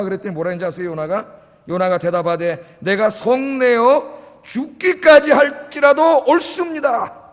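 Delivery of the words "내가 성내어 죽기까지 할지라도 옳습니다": loud, high, drawn-out angry retort; hammed-up furious defiance; defiant outburst. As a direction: act out Jonah talking back to God, loud and slow. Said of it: "하나님 앞에 완전히 덤비는 거야".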